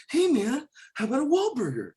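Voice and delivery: high, eager Boston guy; Mark Wahlberg impression. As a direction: pitch it up like Wahlberg's street voice. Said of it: "Mark Wahlberg".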